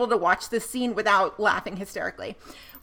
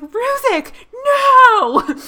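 high, loud, laughing cry of Ruzek, no; mock scream; 14 semitones higher, 10 dB louder, much slower than usual. Laughing podcaster mimicking Roman's scream at the blast, high cracked yell.

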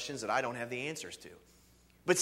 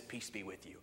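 much softer, lower tender blessing; hushed, tender greeting; gentle blessing; 7 semitones lower, 12 dB quieter than usual. Retold or imitated. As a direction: say it quietly like a blessing. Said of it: "he always says".

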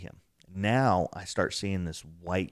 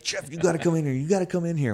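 higher, excited, urgent calling, retold; hyper plea; 9 semitones higher, faster than usual. Running outside to fetch Jeff after the mist, hyper and urgent. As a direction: pull Jeff in, all wound up. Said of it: "we're still hyper about it".

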